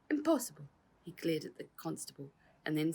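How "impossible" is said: sharp, high, louder retort; defiant outburst; indignant. Acted. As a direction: spit the word at the constable. Cosmo defiant.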